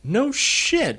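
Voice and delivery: high, drawn-out disbelief; amazed. Real, not acted. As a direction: react astonished, pitch way up. Reacting to Julia Child reveal.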